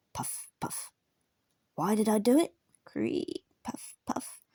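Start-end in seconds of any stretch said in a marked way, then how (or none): 1.7-2.5 loud, raised question; rhetorical outburst. Acted. Smith telling his cat story, loud and raised. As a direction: throw the question out with a flourish.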